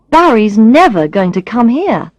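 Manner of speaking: plain reading voice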